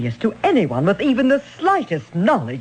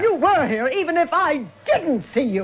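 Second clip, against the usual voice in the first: laughing taunt; 8 semitones higher than usual. Acted. Taunting accusation of suspect.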